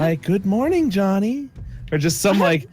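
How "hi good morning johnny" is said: higher, gentle greeting; gentle creepy old man. Retold or imitated. Boss recording a child's morning alarm message, gentle creepy voice. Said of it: "gentle voice".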